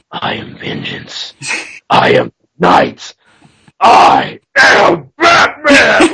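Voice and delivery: gravel whisper building to a huge, slow, high shout; Batman speech. Pretend. Shouting his Batman speech from the roof at night, whisper to roar. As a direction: start as a dark whisper and swell into a roar of I am Batman. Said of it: "after I said my speech".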